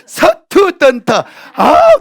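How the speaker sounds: loud, high, drawn-out wail of frustration; hammed-up despairing attempt; exasperated struggle. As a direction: strain out each syllable, then cry out. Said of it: "이 영어로 고생하나".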